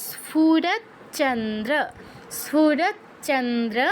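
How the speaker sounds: স্ফুরত চন্দ্র drawn out, both চ clear; slow careful Sanskrit chant; slow demonstration; slow recitation. Shown as a demonstration. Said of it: "তাহলে কি হবে?".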